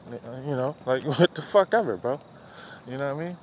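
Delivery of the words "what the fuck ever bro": normal talking voice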